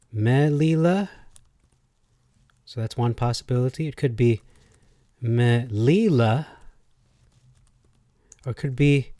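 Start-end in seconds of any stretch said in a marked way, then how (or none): 0.1-1.1 "me lila" with ME punched, higher; MEH-lee-la, stress up front; stress on first syllable. Shown as a demonstration. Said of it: "put a different emphasis on words". 5.2-6.5 "me lila" drawn out, LI punched; meh-LEE-la, deliberate and slow; stress on middle syllable. Shown as a demonstration. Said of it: "put a different emphasis on words".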